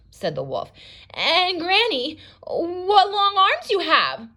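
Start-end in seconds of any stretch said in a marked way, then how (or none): 1.1-4.3 much higher, slow, worried voice; nervous girl. Shown as a demonstration. Red grows nervous at Granny's long arms, high and slow. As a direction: let her worry creep in. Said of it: "she's getting nervous".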